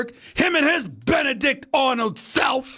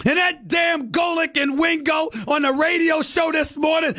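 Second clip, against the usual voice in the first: ordinary delivery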